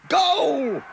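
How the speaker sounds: very high, loud cry of goal; crowd shout. Acted. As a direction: yell it like a cheering fan. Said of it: "the cry went up".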